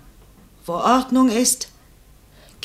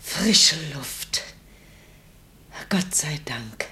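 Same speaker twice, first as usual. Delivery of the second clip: soft, lower whisper of relief; breathy relieved murmur; 6 semitones lower, 5 dB quieter, slower than usual. Acted. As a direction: sigh the words out as the air comes in. Mother breathes fresh air, relieved.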